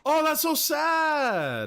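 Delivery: high, slow, drawn-out lament; wailing sympathy. Real, not acted. Streamer reacting to a crying girl, high pitying wail. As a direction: wail it, stretched and rising high before it falls. Reacting to a sad game scene.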